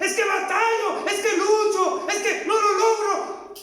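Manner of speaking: believer's complaint cried out high inside the sermon; voicing a struggling believer. Preacher voicing a believer who struggles, high cried complaint. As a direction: plead it like someone who keeps failing. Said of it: "Y dirá alguien".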